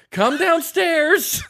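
high, loud, drawn-out holler; yelling mom. Acted. Mom in the kitchen calling kids down, long yell. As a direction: holler it up the stairs, stretched long. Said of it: "and she's yelling".

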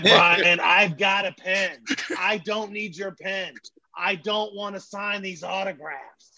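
high, slow, strained rant; acted exasperation, each line drawn out; Bob Knight tirade. Coach scolding his helper for offering a pen, exasperated. Coach blows up after fans leave.

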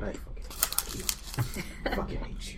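soft unvoiced grumble under breath; muttered curse. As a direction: hiss it under your breath.

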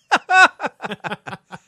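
high loud burst of laughter. Real, not acted. Laugh at the phrase.

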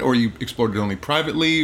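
usual speaking voice

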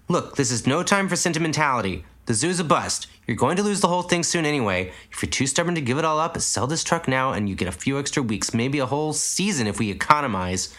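lightly voiced Caprice, scolding; impatient scolding. A narrator's reading. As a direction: snap at him to face facts.

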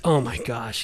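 low, soft imagined reaction; understated aside. Acted. Host imagines the angels reacting to Lot, low muted aside. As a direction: drop it low and quiet, underplayed. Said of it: "these angelics are like".

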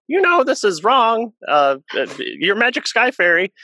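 mocking unbeliever. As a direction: mimic a scoffing online commenter. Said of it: "unbeliever who's like".

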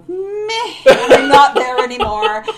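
loud, high, laughing meh; dismissive meh. Retold or imitated. Dismissive retold reaction.

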